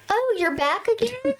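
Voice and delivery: high-pitched questioning lilt, rising at the end; mock surprise. Retold greeting to repeat trick-or-treater.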